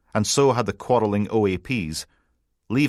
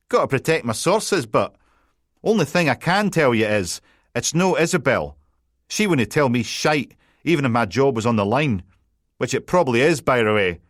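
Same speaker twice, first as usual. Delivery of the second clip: higher and a bit louder, teasing Scots banter; a light cheeky Scottish reporter voice; cocky journalist; 6 semitones higher, 4 dB louder than usual. Acted. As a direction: needle the detective with a grin. Colin smugly guarding sources.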